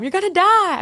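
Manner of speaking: high-pitched retold motherly alarm. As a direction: wail it like a worried mom. Mother's alarm at prison job retold.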